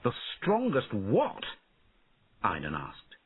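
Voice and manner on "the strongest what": put-on high, questioning boy's voice; boy prince, high and wondering; puzzled young prince. A narrator's reading. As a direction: ask it like a curious boy.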